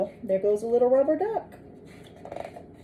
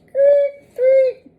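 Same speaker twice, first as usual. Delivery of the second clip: high loud seagull screech; 6 dB louder than usual. Acted. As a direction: screech like a gull. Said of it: "A seagull screeches at it".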